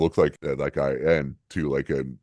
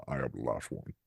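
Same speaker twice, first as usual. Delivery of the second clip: softer, lightly voiced Connery-style dragon; Sean Connery dragon voice; 2 semitones lower, 7 dB quieter than usual. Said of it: "Connery quote".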